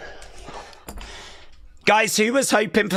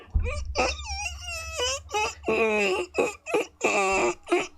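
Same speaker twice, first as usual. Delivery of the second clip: high gleeful laugh; imitated giddy laugh, much higher; 8 dB quieter than usual. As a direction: cackle with wild delight. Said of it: "Big Jim backstage right now is like".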